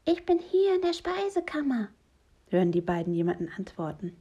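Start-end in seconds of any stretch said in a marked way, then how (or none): 0.0-1.9 much higher-pitched character voice; high little voice calling out from the pantry; high, sing-out call for attention; trapped stranger's voice. Acted. Trapped mystery voice answers from pantry.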